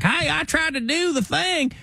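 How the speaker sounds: very high Southern voice. Acted. Doctor telling a patient he tried, high-pitched Southern voice. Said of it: "So higher pitched".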